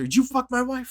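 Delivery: shrill high mock shout; mock yell. Friend acting Cyclops yelling at Sinister, high mock shout. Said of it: "Yelling at Sinister".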